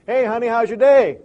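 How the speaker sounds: cheery greeting. Acted. Husband greeting wife home from work.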